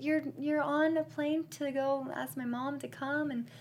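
high, soft, gushing sweetness; imitating touched bride. Bride to groom stuck on a plane, high gushing imitation. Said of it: "but then she's like".

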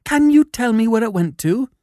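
high, eager little man's plea; anxious cry in a high voice; emotional cry. A narrator's reading. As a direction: beg to know, pitched right up. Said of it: "he cried".

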